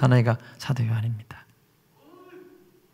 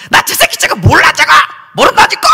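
cursing shout; 11 semitones higher, 6 dB louder than usual. Acted. Peter cursing denial.

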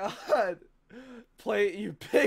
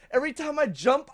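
ordinary talk

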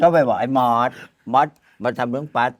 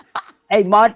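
higher, louder aggrieved whine through laughter; mock angry complaint; 8 semitones higher, 3 dB louder than usual. Actor complaining to the director about the pay, mock angry. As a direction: play the cheated actor, half laughing. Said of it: "โกรธแบบ".

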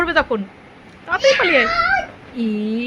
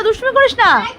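higher, sharper second warning; sharper scolding; 7 semitones higher, 3 dB louder than usual. Shown as a demonstration. Mother warns naughty son again, higher and sharper. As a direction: push the repeat up into a real telling-off. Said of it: "repeated scolding to child".

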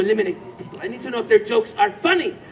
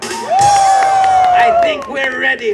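much higher, louder drawn-out shout; hype shout; 14 semitones higher, 8 dB louder, slower than usual. Shown as a demonstration. Host rehearsing applause cues, shouting. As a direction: peak the crowd with a big yell. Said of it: "little rehearsal".

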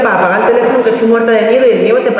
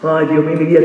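normal talking voice

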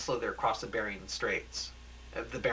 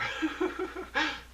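high cackling laugh; giddy triumphant laugh; gleeful high laugh. Acted. Ted gloats at the bomb.

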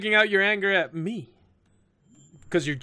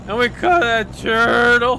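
loud, raised, drawn-out question; excited drunken recollection; 5 semitones higher, 6 dB louder, slower than usual. Acted. Drunk girl reminds old friend of scouting, excited drawl. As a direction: stretch it out loudly.

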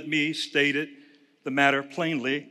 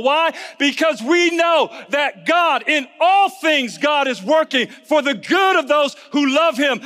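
higher, louder, shouting preacher; preaching at full force; 9 semitones higher, 3 dB louder than usual. Acted. Pastor rousing the congregation with a loud, high shout.

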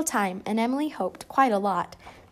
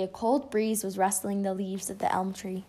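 ordinary delivery, nothing marked

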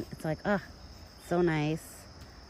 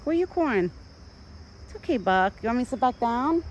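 soothing pet talk; 8 semitones higher, 4 dB louder than usual. Real, not acted. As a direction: coax the frightened deer back to his food. Calming scared deer again.

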